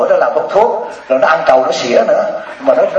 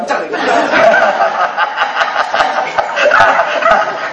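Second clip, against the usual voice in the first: long rising laugh; 2 semitones higher than usual. Real, not acted. Laughing at his own rhyme.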